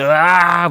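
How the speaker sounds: drawn-out imitated zombie wail; zombie cry. Zombie daughter cries out as door gets locked, imitated wail. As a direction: let out a long mock monster cry. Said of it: "막 문 꽝 걸면서".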